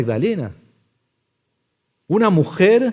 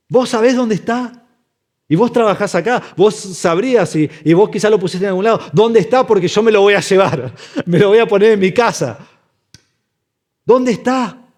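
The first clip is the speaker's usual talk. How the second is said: higher-pitched, insistent acted demands; urgent questioning; 5 semitones higher than usual. Mary demanding the body from the gardener, desperate and insistent. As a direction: press harder with each question.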